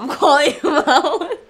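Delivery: gleeful, slow and loud with a laugh. Real, not acted. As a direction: laugh while stretching the words out. Teasing a parent on camera.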